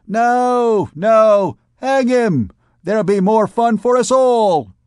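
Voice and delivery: high, loud mocking cry; jeering shout. Vagabond women shouting to hang the poet. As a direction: jeer him down as a rowdy crowd.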